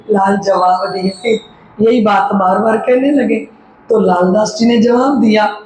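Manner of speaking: normal talking voice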